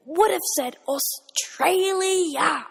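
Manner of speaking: Australia dragged out loud and slow; exasperated stress on Australia. Real, not acted.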